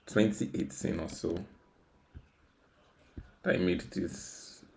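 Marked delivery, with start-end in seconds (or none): none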